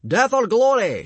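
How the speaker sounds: high, loud, slow war cry; shouting. A narrator's reading. Gobber answers the chief's cry, shouting high and loud. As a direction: roar it like a battle chant. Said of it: "shouted Gobber the Belch".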